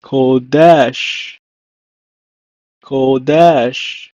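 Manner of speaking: "kodesh" slowly, weight on the second syllable; teacher modelling "kodesh" in two clear syllables; Hebrew pronunciation demo. Shown as a demonstration. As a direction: draw out "kodesh", stressing "desh". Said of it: "In Hebrew, is pronounced as".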